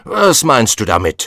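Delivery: the tyrant demanding an answer; furious king interrogating the dwarf; angry. Acted. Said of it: "schrie der Tyrann wütend".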